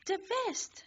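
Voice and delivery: very high shout; very high, frantic shout; frantic yell. Acted. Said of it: "you yell".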